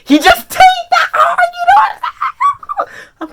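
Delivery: high, loud excited squeal; giddy high-pitched fan yelling; hyped outburst. Real, not acted. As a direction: shriek it out, breaking into laughter. Excited outburst over Arlo's punch.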